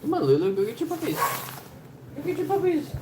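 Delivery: coaxing, climbing into a very high pet voice. Real, not acted. Owner urging Lulu back to her puppies, climbing into a high pet voice. Coaxing dog, pitch rising.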